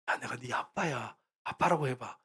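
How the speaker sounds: soft, slow and low, coaxing gently; hushed, patient fatherly voice; warm, loving father. Acted. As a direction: speak tenderly to a frightened child. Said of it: "다 사랑으로 섬기다가".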